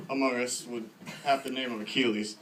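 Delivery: ordinary delivery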